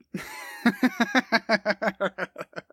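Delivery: real drawn-out chuckling laugh; long laugh. Real, not acted. Laughs after raising the reboot topic.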